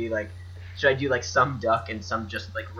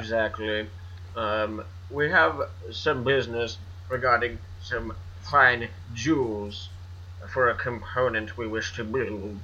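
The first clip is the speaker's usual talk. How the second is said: rich guy voice. Acted. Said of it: "It's my rich guy voice".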